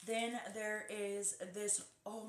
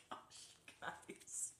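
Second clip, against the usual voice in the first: very soft, breath-only whisper; overwhelmed whisper; 12 dB quieter than usual. Real, not acted. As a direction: lose the voice to a stunned whisper. Overwhelmed by the gift.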